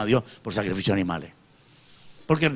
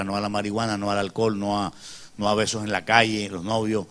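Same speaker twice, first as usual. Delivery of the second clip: usual speaking voice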